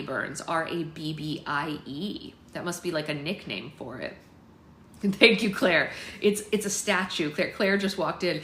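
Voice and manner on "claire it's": ordinary delivery, nothing marked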